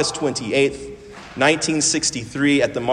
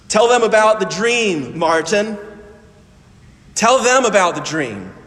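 calling out; 6 semitones higher than usual. Retold or imitated. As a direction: call out to him, urging. Opening, calling out to Martin.

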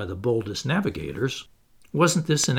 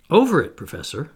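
ordinary delivery, nothing marked

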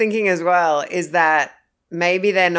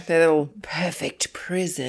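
whispering; 5 dB quieter than usual. Acted. Whispered dark aside.

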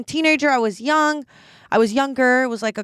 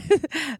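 short high giggle; real laugh; 5 semitones higher than usual. Real, not acted. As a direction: snicker at your own gross story. Laughs at disgusting story.

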